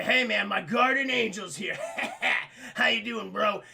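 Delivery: loud, laughing through the words; laughing greeting. Acted. Darby answering his guardian angel, loud laughing greeting.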